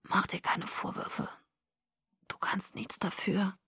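slow, feeble whisper; weak whisper. A narrator's reading. Sick boy tells his friend not to blame herself, whispering. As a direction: whisper reassurance with no strength left. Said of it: "Seine Stimme war immer noch ein Flüstern".

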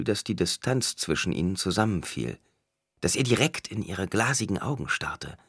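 ordinary delivery, nothing marked

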